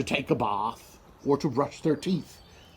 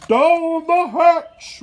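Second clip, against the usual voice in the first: much higher, loud, very slow cheer; crew shouting; 9 dB louder, much slower than usual. A narrator's reading. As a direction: roar it back as the crew. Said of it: "we all shouted".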